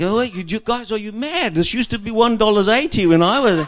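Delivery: high, loud indignant rant; mock husband arguing the price. Husband argues with the server, loud mock indignation. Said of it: "he goes into this argument".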